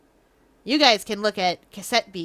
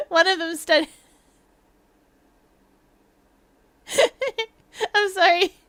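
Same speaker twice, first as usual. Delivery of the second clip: higher-pitched, fighting back giggles; giggly; 6 semitones higher, 3 dB louder than usual. Real, not acted. Telling own bad joke to the chat, giggly. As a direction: struggle through the line while giggling. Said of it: "I'm so giggly tonight".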